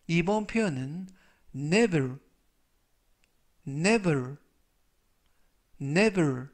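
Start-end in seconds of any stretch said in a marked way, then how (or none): 1.5-6.5 never said clearly, repeated, NEV-er; model pronunciation. Shown as a demonstration. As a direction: demonstrate never three times. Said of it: "이라고 발음하시면 되겠고요". (never 발음 반복 시범)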